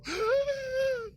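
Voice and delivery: high, shocked theatrical gasp; dramatic gasp. Acted. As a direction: gasp in mock horror. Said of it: "I did it".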